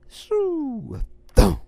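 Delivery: whooshing then thumping sound. A narrator's reading. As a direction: make the falling whoosh. Sound effects of falling lemon.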